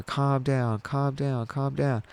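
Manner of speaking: quiet, repeated reassurance; retold consoling. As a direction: gently hush an upset mother. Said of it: "I'm trying to console her".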